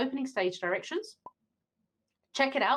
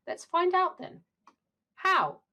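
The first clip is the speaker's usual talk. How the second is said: firm, higher, decisive call; 10 semitones higher, 4 dB louder, slower than usual. Acted. Character decisively proposing to settle the question.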